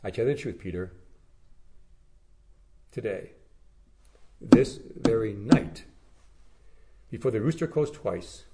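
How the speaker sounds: grave, measured warning to a friend; solemn prophecy. Acted.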